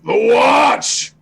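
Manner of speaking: loud shout. Acted. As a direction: shout it so the old lady hears. Repeating for hard-of-hearing old woman.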